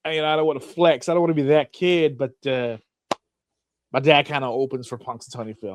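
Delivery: put-on a kid humble-bragging about his dad; hint of a smug schoolkid. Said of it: "you're like".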